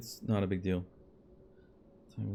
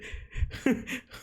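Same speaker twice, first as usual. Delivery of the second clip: airy, high breathless laughter; 8 semitones higher, 3 dB quieter than usual. Real, not acted. Laugh after price shock.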